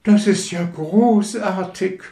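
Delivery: hoch, laut und begeistert ausgerufen. Acted. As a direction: jubel es heraus wie eine entzückte Rakete. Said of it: "rief die Rakete begeistert". (Rakete ruft begeistert und laut)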